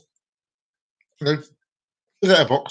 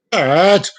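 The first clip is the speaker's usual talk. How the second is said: insistent calling; 3 semitones higher, 6 dB louder than usual. Acted. Cat insists on dad's attention.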